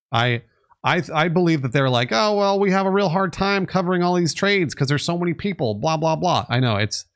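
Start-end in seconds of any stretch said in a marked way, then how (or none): 2.1-6.4 higher-pitched mimicking of a lame excuse; mock excuse. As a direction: rattle off their excuse dismissively. Mimicking brokerage's excuse with blah blah.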